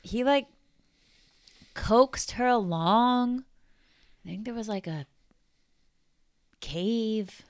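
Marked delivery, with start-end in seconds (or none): none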